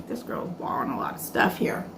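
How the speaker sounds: whispered, low grumble; whispered muttering about the roommate; whispered thought. Retold or imitated. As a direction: whisper it to yourself, annoyed.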